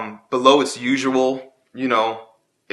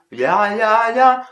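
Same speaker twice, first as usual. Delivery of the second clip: jaw-moving yeah; 9 semitones higher, 4 dB louder, much slower than usual. Shown as a demonstration. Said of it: "that's wrong".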